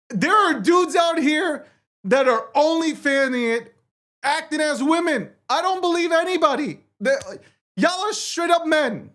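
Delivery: high-pitched, worked-up comic tirade; exasperated rant. Real, not acted. Host complaining about men posing as women, exasperated rant.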